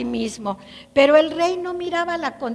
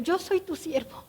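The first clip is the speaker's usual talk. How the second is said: much softer, timid servant's voice; fearful and humble; 3 semitones higher, 10 dB quieter than usual. Acted. Lame prince bowing to the king, soft and fearful. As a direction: shrink before the king. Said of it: "con temor".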